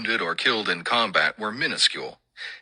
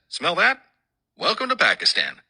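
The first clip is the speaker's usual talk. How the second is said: higher-pitched imitation of a knowing sergeant; lightly voiced sergeant's welcome, pitched up; wry sergeant; 10 semitones higher than usual. Master sergeant to seatmate on landing approach, wry higher-pitched quote.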